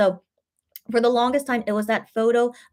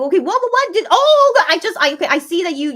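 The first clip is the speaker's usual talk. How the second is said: startled; 9 semitones higher, 8 dB louder than usual. Real, not acted. Startled by a chat message.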